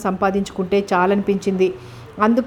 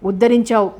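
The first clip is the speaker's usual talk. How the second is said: ordinary delivery, nothing marked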